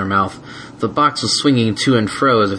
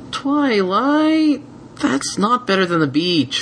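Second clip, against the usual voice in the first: moaning; 13 semitones higher, slower than usual. A narrator's reading. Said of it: "Spike moaned".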